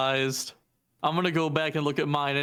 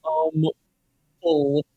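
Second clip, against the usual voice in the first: muffled, like under water; bad mic, muffled underwater sound; 5 dB louder than usual. Real, not acted. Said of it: "Why do you sound like you're underwater?".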